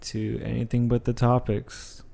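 lax, sleepy voice after lying down; low-energy tired delivery. Real, not acted. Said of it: "why you sound so tired now".

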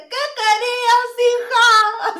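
high sing-song copy of the mom; imitating gringa mother. Co-host copying the gringa mother's line, high and sing-song. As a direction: repeat it up high, checking the voice. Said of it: "¿Así?".

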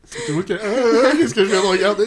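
high, laughing bewildered reply. Retold or imitated. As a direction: laugh through a stunned answer. Mock answer to the teacher.

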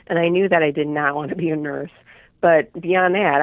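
ordinary talk, nothing marked

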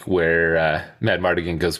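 ordinary talk, nothing marked

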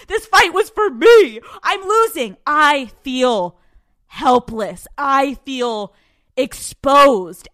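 mock panicked rant. Reliving losing the fight, loud panic. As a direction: spill the panic loudly.

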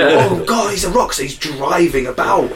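high, loud starstruck gushing; hammed-up amazement; mock awe. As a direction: gasp it like an overexcited fan. Mock awe at eighties video.

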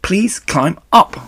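high, loud, pleading cry; desperate. A narrator's reading. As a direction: beg with everything at stake. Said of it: "said Wilbur in desperation".

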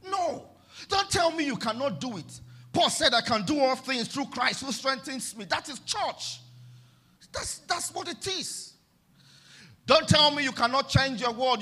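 loud, exhorting climax; fiery preaching. Acted. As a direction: rouse the congregation.

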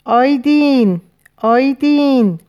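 loud, high drawn-out yell; roaring cry. A narrator's reading. Man expecting to be torn apart by wolves, roaring for Aidin. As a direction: cry the name out at full voice, stretched. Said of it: "نعره کشید".

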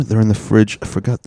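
ordinary talk, nothing marked

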